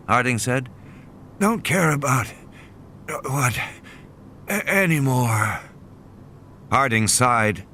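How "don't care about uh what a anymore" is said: delirious murmur. A narrator's reading. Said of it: "slipping into a terminal delirium".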